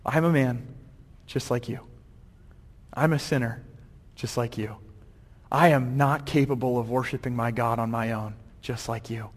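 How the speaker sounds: low, quiet, reflective pauses; hushed, humble realization; thinking it over. Acted. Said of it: "he kinda thinks about it".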